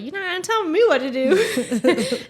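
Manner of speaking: hammed-up refusal to be bossed; mock defiance. Fiancee told not to ride bike, mock defiance.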